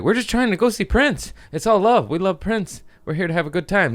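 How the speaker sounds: we mean no harm, honest; high pleading innocence. Retold or imitated. Assuring the security guard they only came to see [name], high and pleading.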